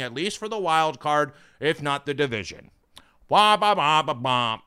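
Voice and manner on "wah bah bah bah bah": Charlie Brown adult voice imitation; trombone-like wah wah nonsense; muffled wah wah talk. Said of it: "Charlie Brown".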